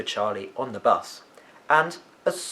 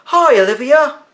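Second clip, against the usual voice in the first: louder, higher friendly call; a boy calling out to his friend running toward him; 12 dB louder than usual. A narrator's reading. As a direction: call across the square to her. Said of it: "called Charlie".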